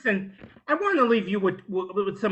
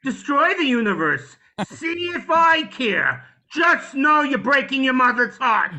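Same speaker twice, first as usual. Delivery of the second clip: loud, high kvetching guilt trip; guilt-tripping superhero; 4 semitones higher, 4 dB louder than usual. Acted. Superhero guilting a villain out of destroying the universe, guilt-tripping. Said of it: "make the villains feel so guilty".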